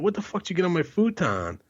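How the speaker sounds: high-pitched, appalled outburst; indignant buddy. Retold or imitated. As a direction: act out a buddy upset about his furniture. Buddy demands answer about futon.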